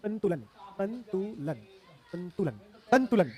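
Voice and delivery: संतुलन drawn out syllable by syllable; slow dictation. Shown as a demonstration. Teacher dictates the corrected word to students, slowly. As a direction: spell out संतुलन slowly by sound.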